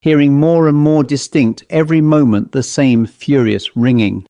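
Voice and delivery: usual speaking voice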